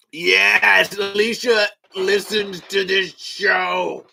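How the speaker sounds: loud, high, drawn-out delight; real elated cheer; thrilled. Real, not acted. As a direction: cry it out slowly, overjoyed. Delighted at a listener's news.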